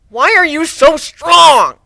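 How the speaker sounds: high, loud, slow pleading wail; desperate cry. Acted. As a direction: beg for the secret. Beaten guard cries out.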